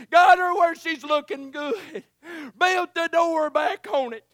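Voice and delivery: loud, high, tearful preaching build; rising preaching fervor. Acted. Preacher telling the congregation about the cellar, rising fervent preaching. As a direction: swell into it, voice catching with feeling.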